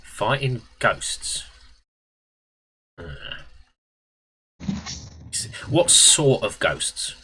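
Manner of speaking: slow, pondering geezer, lightly voiced; Bone Hunter. Geezer asked about fighting ghosts, slow and lightly voiced. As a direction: draw it out like a wary wheeler-dealer. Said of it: "He's a wheeler dealer".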